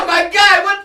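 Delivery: loud, high retold shock; horrified disbelief. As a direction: cry out in mock horror. Retelling his horrified reaction.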